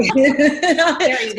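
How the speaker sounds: says it through a laugh; chuckling along, amused; laughing agreement. Real, not acted. Laughing reply to nanny joke.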